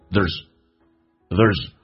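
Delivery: "there's" clipped short, vowel dropped; reduced there's. Shown as a demonstration. Teacher modelling fast "there's". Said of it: "加速的时候可以把那个a去掉".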